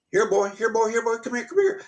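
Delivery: high, coaxing dog-calling voice. Retold or imitated.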